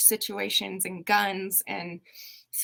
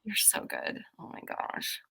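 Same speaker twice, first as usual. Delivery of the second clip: whispered, out of breath from laughing; breathless praise; 6 dB quieter than usual. Real, not acted. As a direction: gasp it out after laughing.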